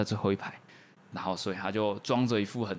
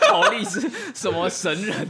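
laughing question; 8 semitones higher, 4 dB louder than usual. Real, not acted. Players laughing at absurd story.

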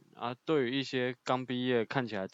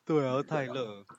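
slow words through a yawn; slower than usual. Real, not acted. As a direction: yawn while saying it. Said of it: "打哈欠哦，我听到。".

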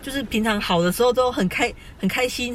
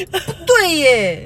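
laughing; 6 semitones higher, 5 dB louder, much slower than usual. Real, not acted. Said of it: "笑，笑到流眼泪".

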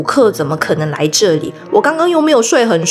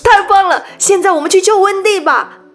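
joyful; 8 semitones higher than usual. Acted. Fairy revived, Peter rejoices.

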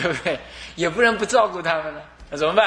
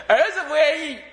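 laughing; 10 semitones higher than usual. Real, not acted. Preacher jokes about the son.

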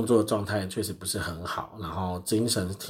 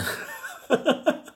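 breathless laugh; high unvoiced laugh. Real, not acted. Laughing at her own snoring, unvoiced laugh. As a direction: giggle at yourself.

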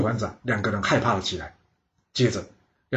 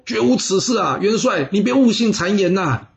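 higher, pleading protest of innocence; urgent denial; 8 semitones higher than usual. Acted. Two lords protesting loyalty to the marshal, high earnest denial. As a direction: insist hard that it never happened.

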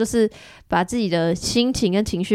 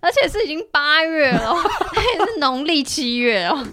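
laughing; 7 semitones higher than usual. Real, not acted. Teasing while laughing.

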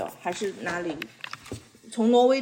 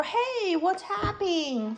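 higher and slow, a puzzled cartoon character; storybook character voice; 8 semitones higher, much slower than usual. Mother reading a picture book to her son, lightly voiced puzzled character. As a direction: read it as the surprised figure in the book. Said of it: "他说".

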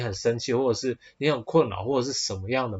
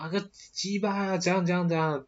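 mock cursing; 7 semitones higher than usual. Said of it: "你可能直接骂".

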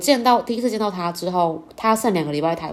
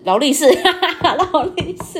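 laughing mock; 9 semitones higher, 5 dB louder, slower than usual. Mocking boyfriend's brand talk, laughing.

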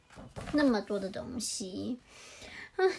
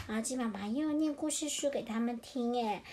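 usual speaking voice

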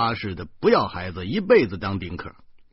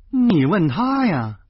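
推给别人的反问; 8 semitones higher, 6 dB louder, slower than usual. A narrator's reading. 叙述者把问题推给诗谦.

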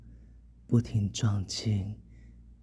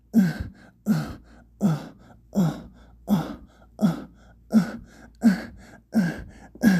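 higher, repeated short moans; acted moaning; 7 semitones higher, 5 dB louder than usual. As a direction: moan rhythmically in short bursts. Erotic story, acted moans. (急促有节奏的呻吟)

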